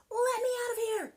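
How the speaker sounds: very high, loud desperate shout; panicked yell. Acted. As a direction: scream to get out of the tilting buggy. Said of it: "yelled Jack".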